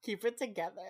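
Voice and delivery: high, loud playful scold; mock scolding. Telling Emily to stop ogling the ranger, mock scold. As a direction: tease her like a flustered friend. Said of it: "And I was like".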